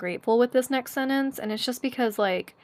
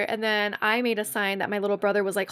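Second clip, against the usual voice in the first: usual speaking voice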